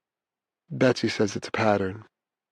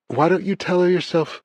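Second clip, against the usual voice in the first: higher-pitched voice of a mother; mother on the phone; 8 semitones higher than usual. A narrator's reading. Mother answers her son by phone.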